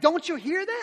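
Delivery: 2 loud, high, over the train; 3 raise it over the roar; 1 shouting over noise. Retold or imitated.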